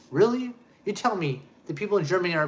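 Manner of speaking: sharp high incredulous "Really?". Real, not acted. To the quoted speaker, disbelieving and incredulous. Scoffing at claim about Germans.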